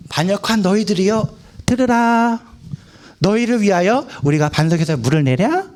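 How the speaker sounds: measured, solemn recital with a sustained ending; stately scripture wording. Shown as a demonstration. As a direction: intone it like an old formal proclamation. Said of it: "이 어투로 모세가".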